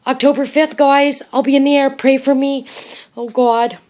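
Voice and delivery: loud, high, overwhelmed gushing; tearfully overexcited. Real, not acted. Grandma signing off before flying, tearfully overexcited. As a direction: call out, overcome with happy emotion. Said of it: "I'm crying".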